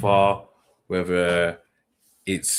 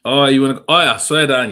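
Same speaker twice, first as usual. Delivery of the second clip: hyped; 6 semitones higher, 10 dB louder, faster than usual. Real, not acted. Loud hype intro to listeners.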